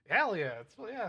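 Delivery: loud laughing "hell yeah"; real laughing whoop; laughing cheer. Real, not acted. Laughing at chat's squid joke.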